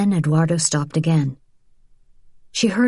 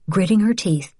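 ordinary delivery

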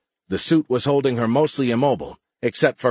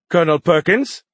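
high, loud formal hail rising at the end; young alien cadet; 8 semitones higher, 7 dB louder than usual. A narrator's reading. Young alien liaison saluting his commander, high formal hail.

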